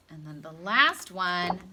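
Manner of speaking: ordinary delivery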